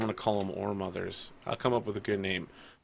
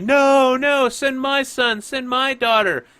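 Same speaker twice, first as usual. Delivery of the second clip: high, loud clamor of rival parents; shouting; 10 dB louder than usual. Acted. Rival parents pushing their own children on the Orefather, shouting. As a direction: shout over everyone, voice pitched way up. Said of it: "shouting, like".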